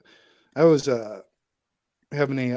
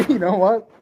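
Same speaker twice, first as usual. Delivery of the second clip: laughing; 3 semitones higher, 6 dB louder than usual. Real, not acted. Still laughing at the joke.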